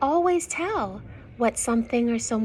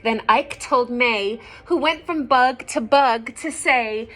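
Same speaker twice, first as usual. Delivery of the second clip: clear, louder storybook reading; story narration; 8 dB louder than usual. Acted. Reading a picture book to young children, lively narration. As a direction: read the rhyme out to the children.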